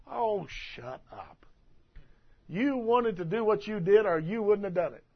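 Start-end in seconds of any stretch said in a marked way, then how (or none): 0.1-1.5 slow, scornful shut up; dismissive. Real, not acted. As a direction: brush them off slowly. Dismissing the excuse-maker.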